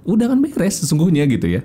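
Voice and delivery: normal talking voice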